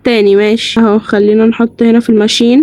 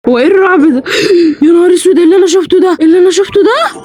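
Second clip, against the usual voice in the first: high, startled panic; scared and half-laughing; frightened; 6 semitones higher, faster than usual. Real, not acted. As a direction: gasp at the jump scare. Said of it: "انا شفت حاجة وخايفة".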